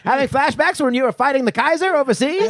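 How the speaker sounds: put-on high, laughing old-timey announcer; 1920s radio pitch through laughter; mid-Atlantic accent.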